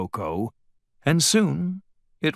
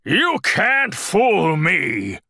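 slow, higher, wavering challenge; growled, uncertain; 7 semitones higher, 4 dB louder, slower than usual. A narrator's reading. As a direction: growl bravely without quite believing it. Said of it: "Bear growled".

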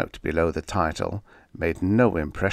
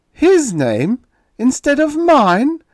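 much higher and louder, slow disbelief; incredulous; 10 dB louder, slower than usual. Acted. Writer stunned at hearing the other name. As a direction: cry out in disbelief. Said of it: "His voice was incredulous".

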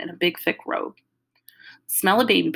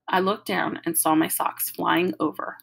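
usual speaking voice

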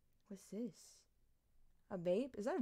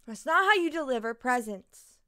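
ordinary delivery

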